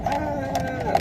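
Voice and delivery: imitated scream. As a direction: hold a long, contained "Ah!" like their screaming. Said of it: "they were screaming".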